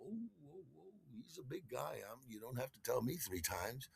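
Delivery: very soft, backing-off murmur; tiny intimidated voice; cowed retreat. Retold or imitated. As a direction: shrink away in a hushed voice. Cowed by big boyfriend.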